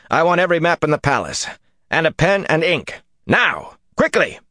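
high, urgent volley of commands; barked orders. A narrator's reading. Rand sending a servant for maps, urgent barked orders. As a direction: fire off each order sharply. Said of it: "he barked at her".

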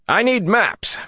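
high, urgent shouted demand. A narrator's reading. Rand demanding maps before the Ogier, urgent high shout. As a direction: shout it out, pitch right up. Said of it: "Rand shouted, so loudly".

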